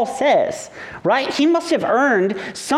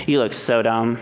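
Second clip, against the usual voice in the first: low-pitched, unimpressed mock grandma; irritated deadpan; 10 semitones lower than usual. Grandma supposedly greeting grandson in costume, low and irritated. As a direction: drop low, dryly dismissive. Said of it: "just, I'm irritated".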